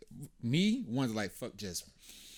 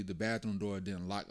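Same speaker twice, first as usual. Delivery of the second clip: usual speaking voice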